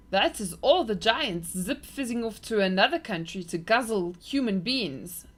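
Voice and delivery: a hint of a big friendly giant confiding; kind giant. A narrator's reading. BFG voiced in story.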